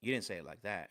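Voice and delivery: low, grave rebuke with weighty pauses; dead serious. Retold or imitated. Coach calling out a trainee in class, dead serious. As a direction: imitate a coach's deadly earnest correction. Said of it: "he looked at me dead serious".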